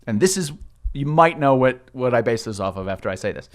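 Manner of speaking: ordinary talk, nothing marked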